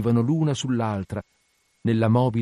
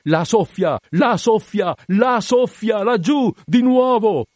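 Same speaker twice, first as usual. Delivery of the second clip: high, drawn-out singing shout; loud lookout's chant climbing in pitch; long melodic yell; 12 semitones higher, 6 dB louder, slower than usual. A narrator's reading. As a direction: intone the whale cry, rising higher each time. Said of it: "urlò in toni lunghi, protratti, melodici".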